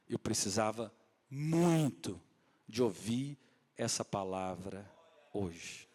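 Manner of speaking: slow, low, heartfelt gratitude; moved confession. Retold or imitated. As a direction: let each phrase land slowly and low. Woman returns composed, moved, grateful. (confissão comovida, lenta e grave)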